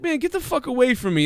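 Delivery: high-pitched mock irritation; annoyed player. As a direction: brush off a pest in an exasperated acted voice. Acted player rebuffing trainer.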